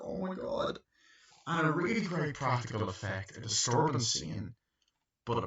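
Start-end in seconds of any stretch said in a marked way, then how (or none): 0.0-0.8 shocked gasp. Retold or imitated. Bystanders react to shooting.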